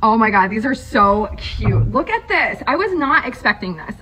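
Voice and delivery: ordinary talk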